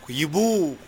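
ordinary talk, nothing marked

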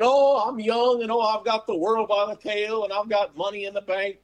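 carefree boaster. Acted. Voicing a carefree, boastful young listener.

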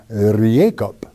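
"Jacob" slowly with Y for J, yee-AY-kup; Hebrew pronunciation. Shown as a demonstration. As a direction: draw out the Y opening of "Jacob". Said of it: "Because there's no J's".

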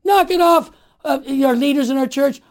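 loud, high, insistent call; speaking up. Pretend. Lay person telling bishops to stop, loud insistent call. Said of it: "It's time to speak up and say".

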